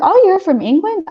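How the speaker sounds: high, bright surprised question. Retold or imitated. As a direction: sound amazed at the news. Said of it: "Sometimes I'm like".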